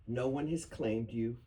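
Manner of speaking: ordinary talk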